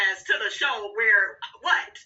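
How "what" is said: ordinary talk, nothing marked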